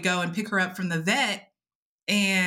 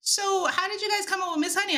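curious people; 9 semitones higher than usual. Retold or imitated. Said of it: "they're like".

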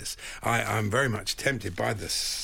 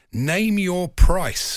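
very slow, loud, higher American offer; desperate American buyer; 6 semitones higher, 8 dB louder, much slower than usual. Retold or imitated. Desperate American pleading for a ticket, drawn-out imitation. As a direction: stretch it out like a grand offer. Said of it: "he was quite desperate".

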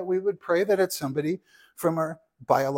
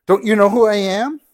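much louder, higher, outraged question; indignant shout; 4 semitones higher, 12 dB louder than usual. A narrator's reading. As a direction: yell it, insulted nobody knows him. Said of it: "he said crossly".

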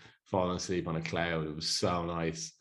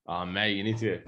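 usual speaking voice

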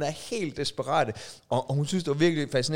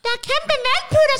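put-on much higher squeaky little-kid voice; tiny child voice; 9 dB louder than usual. Shown as a demonstration. Host showing his co-host the child's voice, high squeak. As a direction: squeak it out like a toddler. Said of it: "du skal lave med nieren stemme".

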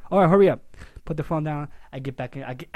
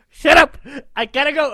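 loud, much higher rude snap; rude shout; 12 semitones higher, 14 dB louder than usual. Retold or imitated. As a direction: blast her off and run. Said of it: "like, very rude".

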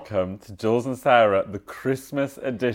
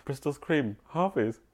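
knowing shop assistant; 9 dB quieter, slower than usual. Retold or imitated. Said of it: "she looked at it and went".

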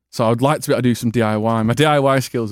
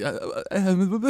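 higher, softer mock dithering; nervous stammering; 6 semitones higher, 7 dB quieter, slower than usual. Pupil put on the spot for monologue, mock nervous. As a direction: stumble slowly over hesitation noises, imitating nerves.

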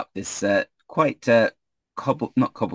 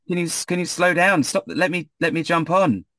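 high, eager stranded traveller; Ismark's voice; 9 semitones higher than usual. Acted. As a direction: wave them down, pitched high. Ismark jogging beside carriage.

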